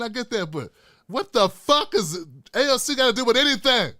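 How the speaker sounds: usual speaking voice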